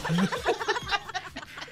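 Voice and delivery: high soft laugh. Real, not acted. Laughs after teasing remark.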